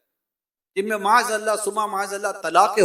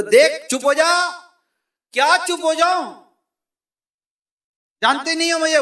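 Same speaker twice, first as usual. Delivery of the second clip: louder, threatening warning to shut up; angry warning; 5 semitones higher, 5 dB louder than usual. Acted. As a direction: warn her with rising temper. Husband warns wife in quarrel.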